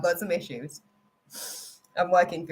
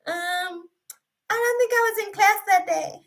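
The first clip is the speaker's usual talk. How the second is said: much higher, sheepish schoolgirl voice; mock clueless student; 14 semitones higher than usual. Retelling excuses to God as a clueless student. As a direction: play the kid who missed class. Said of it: "I've been like".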